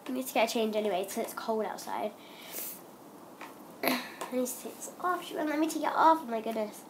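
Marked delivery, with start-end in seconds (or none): none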